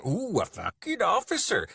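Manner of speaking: high, loud mock-impressed swoop. Soldier jeers at his rival's rank, mockingly. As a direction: coo it sarcastically in a high voice.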